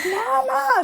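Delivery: put-on high, drawn-out child's call of Mama; a child calling Mama from below, high and pleading. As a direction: plead up at mom like a kid.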